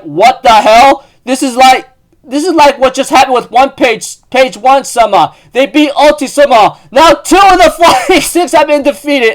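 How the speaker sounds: high, loud freak-out; panicked squeal. Retold or imitated. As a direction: babble it out in shock. Bao freaks out at the defeat.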